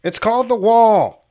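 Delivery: loud, high, sing-out jeer; mocking taunt. Male viewers taunting a single mom, mocking. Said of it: "my male viewers just said".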